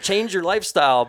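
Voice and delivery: high, loud mock disbelief; playfully scandalised high voice; incredulous young listener. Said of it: "they're like".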